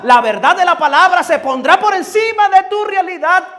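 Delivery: high, loud, fervent preaching; preaching at full force. Acted. Preacher lifting the congregation with promises, fervent full-force preaching. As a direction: preach it to the rafters.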